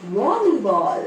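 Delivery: वॉलीबॉल with an open वॉ; model word. Shown as a demonstration. Teacher repeating वॉलीबॉल for children, clear model. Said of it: "फिर से बोलिए".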